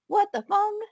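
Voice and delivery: high, baffled "what the"; surprised. Retold or imitated. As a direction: squeak it out in mock disbelief. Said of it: "I was like".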